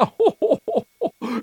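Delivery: high, loud character laugh; hearty acted laugh at childhood memories; risata recitata. Il magro ride dei soprannomi. (risata acuta e sonora, recitata)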